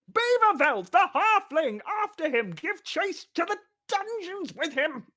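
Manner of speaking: very high, loud protest; exasperated bellow. Acted. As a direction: bellow the correction. Official ordering the chase.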